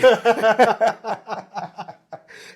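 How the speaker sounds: loud, high burst of laughter; real laughter. Real, not acted. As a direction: crack up at your own punchline. Laughs at own punchline.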